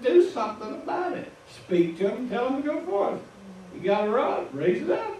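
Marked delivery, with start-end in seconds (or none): 0.0-3.2 lightly voiced God, higher, urging; God's order. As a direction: tell Moses to get on with it. God ordering Moses to act.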